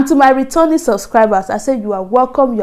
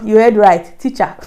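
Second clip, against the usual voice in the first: ordinary delivery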